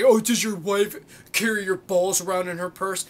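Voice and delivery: loud put-on sneering voice; mocking taunt. Vlogger re-enacts an opponent's jibe about the wife, mocking voice.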